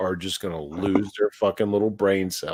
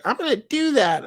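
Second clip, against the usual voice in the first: high, bright wife's voice; 13 semitones higher than usual. Retold or imitated. Husband quoting wife's camera plan, high voice. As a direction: sell the plan cheerfully as the wife. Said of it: "she's like".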